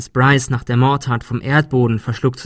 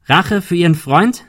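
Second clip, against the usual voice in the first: higher, louder rising question; calling out; 6 semitones higher, 5 dB louder than usual. Acted. Ben challenging the man in the fog, calling out. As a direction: fling the question at him. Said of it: "rief Ben".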